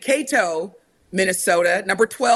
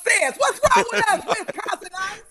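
comic exasperation; 11 semitones higher than usual. Real, not acted. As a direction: wail it in mock outrage at your own people. Mock outrage at city list.

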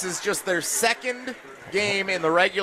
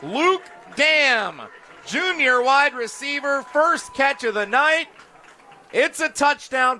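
excited play-by-play call; 9 semitones higher, 5 dB louder, slower than usual. Real, not acted. Announcing the touchdown scorer.

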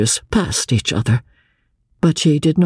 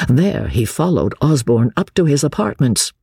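ordinary delivery

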